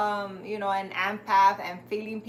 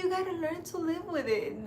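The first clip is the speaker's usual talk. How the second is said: put-on sweet old psychic; 7 semitones higher than usual. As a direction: coo it like a kindly grandma giving advice. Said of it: "She's like".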